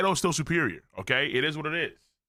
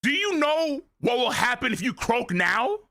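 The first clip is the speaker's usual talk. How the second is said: lightly acted, higher scolding father; a touch of fatherly rebuke; stern father; 7 semitones higher than usual. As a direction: lecture like a concerned stern dad. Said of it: "it's a stern father pick-me-up".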